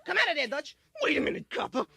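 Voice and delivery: lightly voiced tough-guy movie lines; a hint of a gangster standoff; Kirk Douglas. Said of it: "Kirk Douglas".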